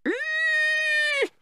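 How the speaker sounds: very high frightened squeak; a tiny terrified eek. Acted. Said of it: "squeaked Golg".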